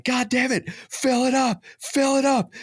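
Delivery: high, loud urgent shouting; frantic yell. Retold or imitated. Yelling at his wife to refill the sprayer, frantic. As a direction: bark the order in panic. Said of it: "I'm yelling again".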